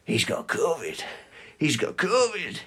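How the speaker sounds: hushed imitation of gossiping onlookers; soft, scandalised stage murmur; mock whispering. Onlookers gossiping as the covid patient walks out, mock whisper. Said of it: "I can hear people whispering".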